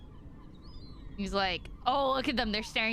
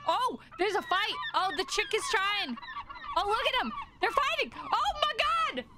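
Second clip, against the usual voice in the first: high-pitched, climbing cries; alarmed excitement; 10 semitones higher, faster than usual. Real, not acted. Viewer watching chicks fight, mounting alarm. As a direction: get higher and more frantic as it unfolds.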